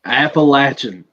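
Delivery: Appalachian drawn out, with "latch" in the middle; Appalachian with a short A and CH, stretched; local slangy pronunciation. Shown as a demonstration. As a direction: teach Appalachian slowly as "apple-atch-un". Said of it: "put a little slang in it".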